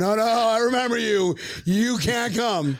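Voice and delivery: high, hammed-up turning away; mock refusal. Border official recognizing the traveler, mock refusal.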